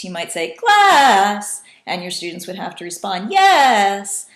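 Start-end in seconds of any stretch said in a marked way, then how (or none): 0.5-1.6 high, loud, drawn-out sing-song call. Shown as a demonstration. Teacher calling the class to attention, sing-song. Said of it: "in a very sing-song voice". 3.3-4.3 high, loud, long sung-out "yes"; sing-song. Shown as a demonstration. Students answering the teacher, sing-song. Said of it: "very sing-song voice".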